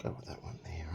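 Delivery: barely-voiced aside; whispered aside. Real, not acted. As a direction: whisper it to himself. Unvoiced whisper.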